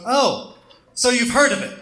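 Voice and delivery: put-on a sarcastic dig at the old man; snarky. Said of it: "love that snarkiness".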